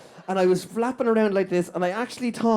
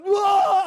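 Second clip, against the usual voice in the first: high, loud cry of alarm; acted panic yell, pitched way up; panicked whoa; 11 semitones higher, 5 dB louder than usual. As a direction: cry out as if a wave looms. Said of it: "And I just panicked".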